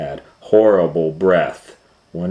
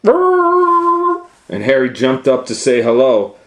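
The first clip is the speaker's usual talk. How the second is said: much higher, louder and slow; excited build-up; 10 semitones higher, 9 dB louder than usual. Acted. Excited climax narration.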